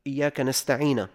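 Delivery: نستعين said "nasta'ina", ending in a; fatha on nun. Shown as a demonstration. Teacher showing a mistake, نستعين said "nasta'ina". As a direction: put an a vowel on the last nun of نستعين. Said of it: "قال بدل".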